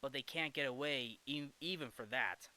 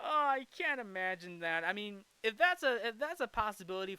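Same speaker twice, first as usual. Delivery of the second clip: amused; 6 semitones higher, 5 dB louder than usual. Real, not acted. Amused after the joke.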